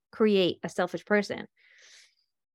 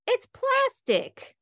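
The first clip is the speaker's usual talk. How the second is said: high, slow amused realization; lilting high reveal; surprised discovery; much slower than usual. Retold or imitated. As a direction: draw it out, amazed it's fake. Revealing the fake snake.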